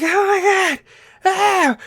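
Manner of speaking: high, shrill mock panic shriek; panicked shriek. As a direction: squeal like rain is deadly. Panicking parkgoers in the rain.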